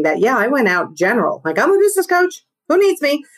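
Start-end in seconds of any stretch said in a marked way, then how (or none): 1.6-3.2 high, bright mock enthusiasm; chirpy imitation of a new coach; mock eager pitch. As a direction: play your hopeful younger self, pitched up. Retold eager early self.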